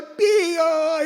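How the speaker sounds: high little bird peep; chirp. Retold or imitated. Pastor mimicking a baby bird begging, high chirp. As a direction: peep like a hungry chick.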